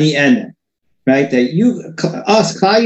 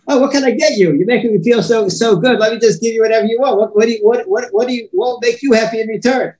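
higher-pitched, eager to give anything; delighted doting adult; 5 semitones higher than usual. Acted. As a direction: gush like an adult won over by a child. Voices adult delighted by child.

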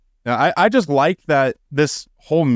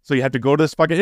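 ordinary delivery

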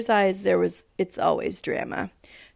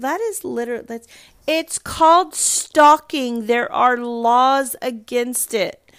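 indignant; 3 semitones higher, 6 dB louder than usual. Real, not acted. Indignant about stalking.

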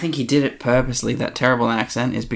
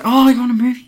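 much higher, louder doting mum voice; eager mum; 12 semitones higher, 6 dB louder, faster than usual. Retold or imitated. Podcaster playing the actor's mum offering tapes, high and eager. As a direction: chirp it like a proud mum. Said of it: "she's like".

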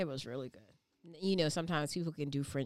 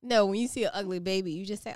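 usual speaking voice

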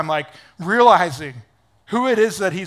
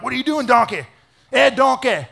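Shrek; 5 semitones higher, 3 dB louder than usual. Acted. Balaam scolding his freaked-out donkey, as a Shrek impression. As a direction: exclaim it like a cartoon ogre at his donkey. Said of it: "without thinking of Shrek".

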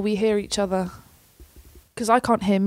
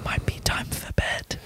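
unvoiced sleepy whisper; 5 dB quieter than usual. Real, not acted. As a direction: breathe the words out softly. Tired aside wrapping up.

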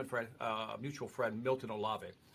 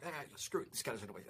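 muttered under the breath, much softer; inner cursing; 9 dB quieter, faster than usual. Retold or imitated. Guest recalls silently cursing his mentor, muttered softly. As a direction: grumble it to yourself, barely voiced. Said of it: "I cursed you in my head".

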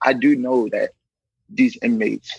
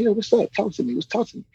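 gentle coaxing; 10 semitones higher than usual. Retold or imitated. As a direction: ease him into talking. Officer coaxing crying inmate.

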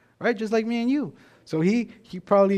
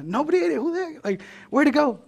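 much higher, hammed-up innocent surprise; mock bafflement; 10 semitones higher than usual. Sibling denying eating the milkshake, fake surprise. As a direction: play dumb, squeaky and wide-eyed.

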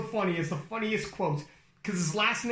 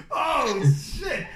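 higher, louder laughing shout; shocked laughing exclamation; 6 semitones higher, 6 dB louder than usual. Retold or imitated. Host retelling his reaction, laughing shout. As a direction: blurt it out cracking up. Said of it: "It was like".

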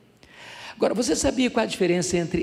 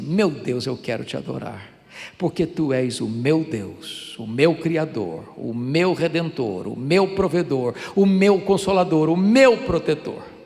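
prayer of adoration; 3 semitones higher, 6 dB louder than usual. Shown as a demonstration. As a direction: list God's titles with swelling force. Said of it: "vou fazer uma oração agora de adoração". (oração de adoração, crescendo até o clamor)

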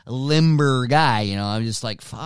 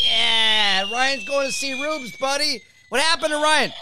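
high excited buddy voice; excited greeting; 11 semitones higher than usual. Retold or imitated. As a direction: greet him like an old pal. Imitating friends greeting him.

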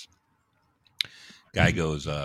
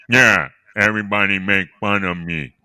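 louder odd put-on voice for the patient; strange speech pattern; 5 dB louder than usual. Patient tells doctor he gets mocked, odd voice. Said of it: "it's a very strange pe-, speech pattern you have".

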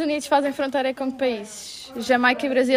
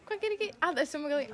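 usual speaking voice

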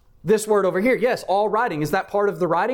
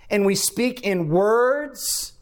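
slow emphasis; 4 semitones higher, much slower than usual. Real, not acted. Slow emphatic quoting of scripture.

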